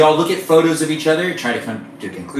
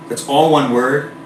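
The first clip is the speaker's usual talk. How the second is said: normal talking voice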